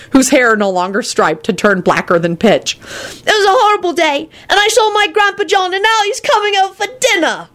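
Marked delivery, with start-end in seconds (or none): 3.2-7.5 put-on high-pitched child's wail. A narrator's reading. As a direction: wail it like an upset little boy. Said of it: "wailed Teddy".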